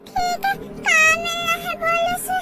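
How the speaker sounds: tearful plea. Acted. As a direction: cry out as the line goes dead. Tearful plea, call cut.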